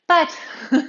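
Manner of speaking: breathless, puffing between words; out of breath. Real, not acted. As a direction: talk while catching your breath. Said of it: "I'm out of breath".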